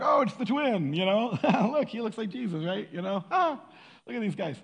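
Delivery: high, laughing mock-astonished crowd voice; amused onlookers laughing. Onlookers spotting Jesus's lookalike, high and giggly. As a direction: chuckle through it like gawking onlookers.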